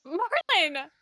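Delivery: loud, high cry of reproach; exasperated shout. Acted. Companion scolding Marlin for eating the mushroom, exasperated yell. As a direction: yell the name in protest.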